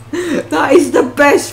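amused, laugh-broken delivery; real laughing. Real, not acted. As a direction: crack up at your own silly phrasing. Cracks up at own phrasing.

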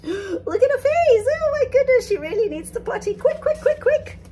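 high, loud, fast alarm; urgent panic. Acted. Narrator reacting to the princess's face, high urgent alarm. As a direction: hurry everyone, she can't wait.

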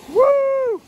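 a high, excited whoo; high excited whoop. Real, not acted. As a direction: let out a high joyful whoo. Cheering at the bass.

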